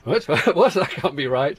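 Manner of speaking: high, laughing mock incredulity; laughing disbelief. Phonetician joking about Churchill's stresses, in laughing disbelief. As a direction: protest through a laugh, pitch jumping up.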